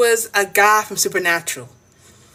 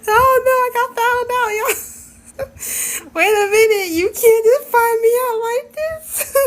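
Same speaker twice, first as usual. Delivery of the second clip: flustered squeal; 13 semitones higher than usual. Real, not acted. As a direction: shriek like you've been caught. Caught out, squealing.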